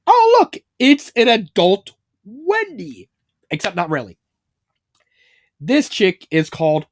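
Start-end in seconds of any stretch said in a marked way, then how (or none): 0.1-3.1 high startled yelp, then slow; caught off guard. Retold or imitated. Mario seeing the grown-up Wendy's face, startled high cry. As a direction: jump at the sight, then gape. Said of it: "completely caught off guard".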